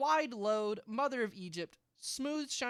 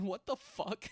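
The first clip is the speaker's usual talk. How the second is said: laughing; 4 semitones higher, 3 dB quieter, slower than usual. Real, not acted. Laughing at fanfiction.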